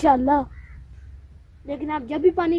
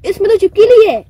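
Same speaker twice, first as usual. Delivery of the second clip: loud, high burst of alarm; startled exclamation; 5 semitones higher, 13 dB louder than usual. Acted. Friend discovers a lizard in the water jug, startled shout. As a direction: cry out in shock, voice jumping up.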